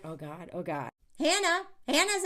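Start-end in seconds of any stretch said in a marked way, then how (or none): 0.0-0.9 low, soft mortified "oh God"; embarrassed groan. Retold or imitated. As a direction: cringe quietly in embarrassment. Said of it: "so many women on the show are like".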